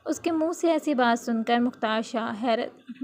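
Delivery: plain reading voice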